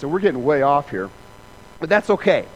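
ordinary talk, nothing marked

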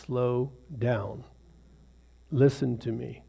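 soft, hushed voice of God; still small voice. Retold or imitated. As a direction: speak it gently as a quiet inner voice. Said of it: "that still small voice say".

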